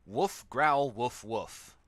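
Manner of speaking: a mild mock bark and growl; guard dog barking. Narrator reading the guard dog's line as a light mock bark. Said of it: "the dog wolfs down the hamburger".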